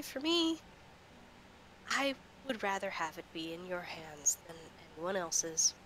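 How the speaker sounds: ordinary talk, nothing marked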